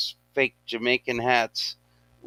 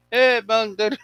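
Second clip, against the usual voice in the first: high, loud mock Jamaican accent; 12 semitones higher, 5 dB louder than usual. Retelling a Rasta gorilla meme, high Jamaican accent. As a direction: put on a high-pitched Jamaican stoner accent. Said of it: "with the Jamaican accent".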